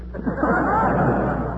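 short high laugh. Real, not acted. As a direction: laugh at the joke. Joke about eating records.

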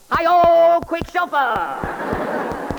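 big high shout, then drawn out slow; rallying shout. Acted. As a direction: bellow it like a cowboy hero. Mock cowboy rallying cry.

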